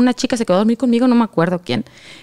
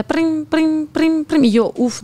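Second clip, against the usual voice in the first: higher-pitched "prim" vibration noises; rapid repeated buzzing syllables; imitated phone buzz; 5 semitones higher, much slower than usual. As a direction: mimic an iPad buzzing with alerts. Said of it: "empieza a vibrar".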